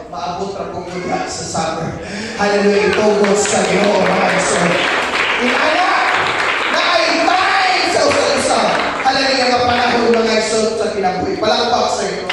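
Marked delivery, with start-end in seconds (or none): 2.4-12.3 rally the congregation loudly; full-force preaching. Real, not acted. Loud preaching with clapping call. (kusog nga pagsangyaw)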